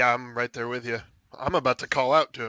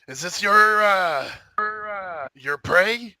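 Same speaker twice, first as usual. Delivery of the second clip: high, loud, slow yell; 7 semitones higher, 3 dB louder, slower than usual. Acted. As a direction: yell it across the ravine. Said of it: "I'm yelling at the".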